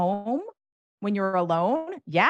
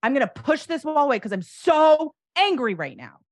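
higher and louder, pushing it out; venting anger; 8 semitones higher, 6 dB louder than usual. Shown as a demonstration. Adult demonstrating angry coping, higher and louder. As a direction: say it like you're shoving a wall. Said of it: "coping skills around anger that you're demonstrating".

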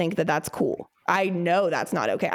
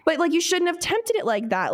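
higher, lightly scolding; mock lecture; 8 semitones higher than usual. Lecturing the neighbor about her bird, higher and scolding. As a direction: scold her like she should know better. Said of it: "I'm like".

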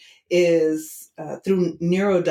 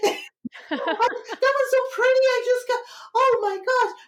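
very high-pitched, mock-gushing fan voice; gushing tarot fans. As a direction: imitate people swooning over the cards, way up high. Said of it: "like how people are like".

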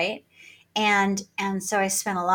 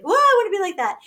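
awed excitement; 12 semitones higher, 6 dB louder, faster than usual. Retold or imitated. Quoting her younger admiring self.

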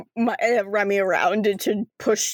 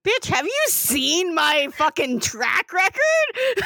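mock outrage; 6 semitones higher, slower than usual. [name] mocks Remy's fear.

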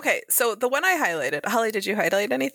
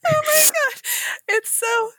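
overwhelmed gush. Real, not acted. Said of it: "overcome after reading".

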